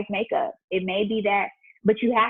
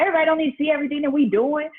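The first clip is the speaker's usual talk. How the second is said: fast, higher, louder mock husband; hammed-up annoyed husband, rushed and raised; imitating husband; 8 semitones higher, 5 dB louder, much faster than usual. Wife mimicking her husband scolding her for taking pictures. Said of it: "But he was like".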